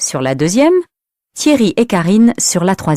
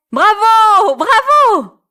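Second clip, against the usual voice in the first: high cheering bravo; 13 semitones higher, much slower than usual. Acted. Friends cheer Elsa after the song, high.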